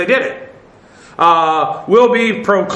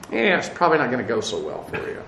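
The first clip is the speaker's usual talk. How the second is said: low, quick, dry understatement; understated; 6 semitones lower, 8 dB quieter, faster than usual. Real, not acted. Teacher to class, dryly understating Pharaoh's fate. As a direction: toss it off as a mild understatement. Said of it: "to say it, uh, uh, mildly".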